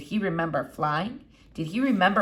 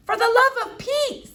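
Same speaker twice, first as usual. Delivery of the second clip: put-on much higher, louder exasperated girl; exasperated Flora; 6 dB louder than usual. A narrator's reading. Girl exasperated by the doctor, much higher. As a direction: throw up your hands at the doctor.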